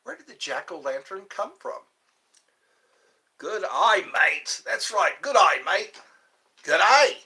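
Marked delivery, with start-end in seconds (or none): 3.4-7.2 loud, higher Aussie cheer; mock Aussie accent. Cheering a chatter in mock Aussie accent. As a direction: call it out like an Aussie mate.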